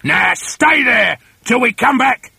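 loud, high trainer's command; barked order. Acted. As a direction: order them sternly to stay put. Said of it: "Mister Twit ordered".

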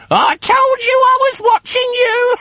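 very high, loud witchy crow; cackling hag. A narrator's reading. Wife gloats about her eye in his beer, high cackling hag. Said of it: "cackled Missus Twit".

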